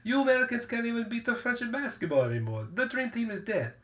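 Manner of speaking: high-pitched mock French accent; imitated French accent. Podcaster as French driver mocking American basketball, French accent. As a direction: taunt like a Frenchman, accent hammed up.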